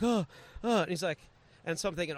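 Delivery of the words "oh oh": high, flustered imitation of a nervous chef; higher-pitched fretful oohs; mock freaking out. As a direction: play a panicked waiter's startled little noises. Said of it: "he starts freaking out".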